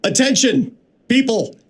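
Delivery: high-pitched, bossy train announcer; own train announcement. Shown as a demonstration. Candidate redoes his audition for the train voice, bossy high announcement. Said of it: "here's how I did it".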